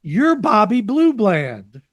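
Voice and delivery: drawn-out emphatic claim; hammed-up insistent fan.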